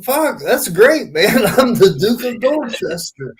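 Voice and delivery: embrace the nickname with glee; proud, laughing delight. Retold or imitated. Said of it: "he fucking owned it".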